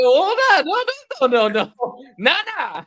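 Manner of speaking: loud, high-pitched protest, re-enacted; alarmed refusal. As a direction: shut it down in a burst of mock panic. Acts out refusing her request.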